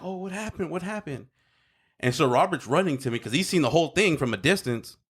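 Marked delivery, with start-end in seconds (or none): 0.0-1.3 higher, hushed worried sister; imitating sister. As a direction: mimic the frantic sister softly. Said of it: "my sister's like".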